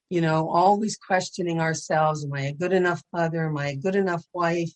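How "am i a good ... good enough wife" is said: usual speaking voice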